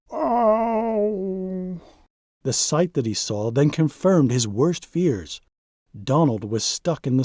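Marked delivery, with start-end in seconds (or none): 0.1-1.8 wail. Acted. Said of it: "heard a wail".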